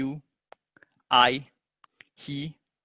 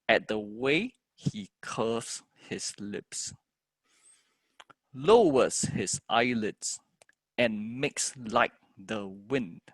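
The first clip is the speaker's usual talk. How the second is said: slow, measured verse, stressed words stretched; poem reading; 3 semitones lower, much slower than usual. Shown as a demonstration. Teacher continuing the poem, slow stressed recital. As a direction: recite it slowly, phrase by phrase.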